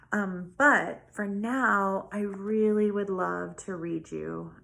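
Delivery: usual speaking voice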